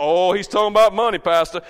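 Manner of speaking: loud, whining objection in a member's voice; mock complaint. Church member grumbles to pastor about money, mock complaint. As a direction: blurt it out as a loud mock protest.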